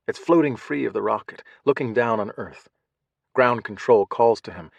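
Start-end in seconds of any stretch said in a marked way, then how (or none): none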